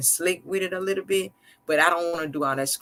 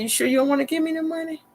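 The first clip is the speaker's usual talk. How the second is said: sweet-talking coax; 12 semitones higher, faster than usual. Retold or imitated. Coaxing husband for money.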